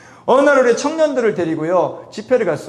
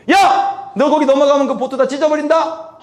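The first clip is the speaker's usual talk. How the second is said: high, sudden retold shout; abusive yell; 6 semitones higher, 3 dB louder than usual. Lifeguard warns youths off the boat line, mock yell. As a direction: imitate an angry warning hollered over water. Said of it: "하고 막 욕을 하는 거야".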